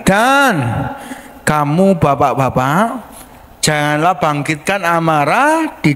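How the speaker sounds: slow, measured reading aloud; scripture reading. Acted. Pastor leading the congregation in slow scripture reading. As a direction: read the verse slowly with the church.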